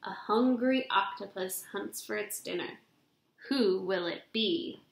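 ordinary delivery

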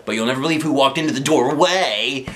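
ordinary delivery, nothing marked

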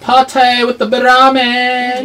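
high, loud, slow hello; loud cheery greeting. Real, not acted. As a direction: hail them from afar. Greeting traders loudly.

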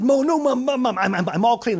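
high, loud, stammering denial; nervous child. Acted. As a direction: stammer out a fib to mum. Bridget nervously lies to mum.

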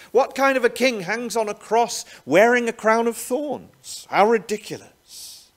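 loud, high scornful jeer; mocking. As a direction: scoff at the crowned man. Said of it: "their mocking notice".

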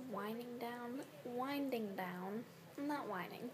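winding down drawn out slow and soft. Shown as a demonstration.

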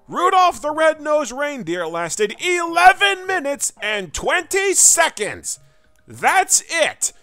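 high, loud incredulous rant; exasperated rant. Real, not acted. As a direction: rant at how short it is. Ranting about game length.

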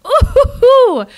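high, loud, drawn-out whoop of glee; excited whooping hoot. Real, not acted. Host cheering the puzzle reveal with a high whoop. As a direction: whoop like the answer delighted you.

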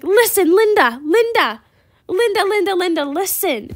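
put-on high, loud pleading kid voice, fast repeats; begging little kid. Little boy begging his mom Linda for a cupcake, high whiny plea. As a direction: beg like a small boy desperate for a cupcake. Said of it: "He's like".

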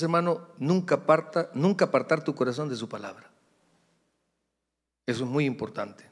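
normal talking voice

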